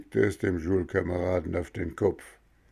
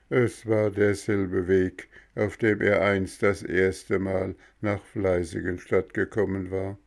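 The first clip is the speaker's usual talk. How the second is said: usual speaking voice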